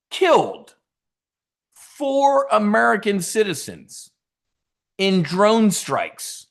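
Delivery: a slow, loud, indignant build; outraged emphasis. Real, not acted. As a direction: pound each word in outrage. Outraged point about drone strikes.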